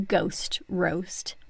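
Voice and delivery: hushed, slow reveal; dramatic whisper. Pretend. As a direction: whisper it like a dark secret. Dramatic reveal of dad's business.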